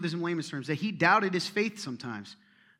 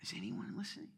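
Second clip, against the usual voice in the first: hushed whispered aside; 4 semitones lower, 12 dB quieter than usual. Real, not acted. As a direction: lean in and whisper it.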